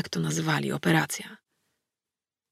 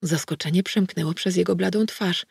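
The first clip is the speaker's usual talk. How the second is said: ordinary delivery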